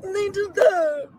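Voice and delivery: mock sob. Acting sobbing grief.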